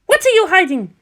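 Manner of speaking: high, pressing woman's voice demanding an answer; demanding young woman. Acted. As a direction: push hard for the truth in a high voice. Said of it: "Jamyang demanded".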